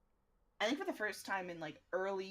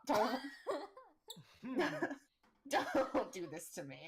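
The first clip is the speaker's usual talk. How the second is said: laughing; 6 semitones higher, 3 dB louder, slower than usual. Real, not acted. Laughing plea to friend.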